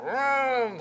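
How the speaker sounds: imitated engine rev, drawn out; engine vroom. Preacher telling the congregation about towing, imitated engine vroom. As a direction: mimic the truck pulling the car out.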